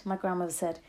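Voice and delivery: plain reading voice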